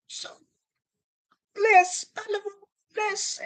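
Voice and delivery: put-on high squeaky kid voice; imitating a kid. Comic imitating a kid answering, high squeaky voice. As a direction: pipe it up like a small child.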